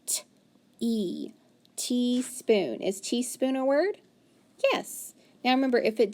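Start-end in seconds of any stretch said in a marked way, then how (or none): 0.0-1.3 T-E sounded out as separate parts; sounding out. Shown as a demonstration. Teacher to student, segmenting the word. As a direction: break T-E apart slowly.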